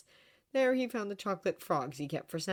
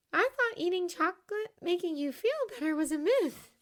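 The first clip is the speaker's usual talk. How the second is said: put-on high girl's voice; young girl voice; 13 semitones higher, 3 dB louder than usual. A narrator's reading.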